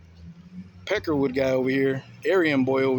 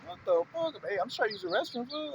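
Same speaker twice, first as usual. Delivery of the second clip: indignant retort; 10 semitones higher, 3 dB quieter than usual. Retold or imitated. Retelling his own retort.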